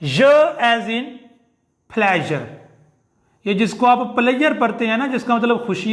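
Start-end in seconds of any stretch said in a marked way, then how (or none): 0.0-2.4 य as in pleasure, drawn out slowly; teacher demo of य; slow sound demo. Shown as a demonstration. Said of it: "य तशदीद के साथ".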